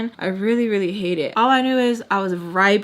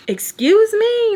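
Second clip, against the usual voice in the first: high, loud, drawn-out indignant question; snapping; 13 semitones higher, 7 dB louder, slower than usual. Retold or imitated. Reenacting how she snapped back, indignant snapping. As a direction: stretch it out in loud, high affront. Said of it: "Like, I just snapped".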